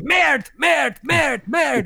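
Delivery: high-pitched French cursing, mock shout; imitated shouting. Imitating Napoleon after he messed up the bowling, shouting. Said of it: "as he's shouting".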